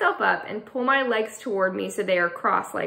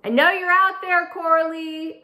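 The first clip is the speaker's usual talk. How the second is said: high, loud call through a window; calling up through glass; yelling up; 8 semitones higher, 4 dB louder than usual. Acted. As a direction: shout it up to someone outside. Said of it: "I yell up through the closed window".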